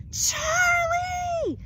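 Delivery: high, loud shriek of a name; wife screaming for her husband, panicked; frantic shout. Acted. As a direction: call out in panic. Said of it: "shouted Missus Newcomb".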